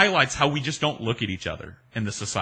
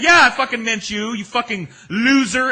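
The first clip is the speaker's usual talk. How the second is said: plain loud insult; 12 semitones higher, 9 dB louder than usual. Retold or imitated. She confirms insult, yelling.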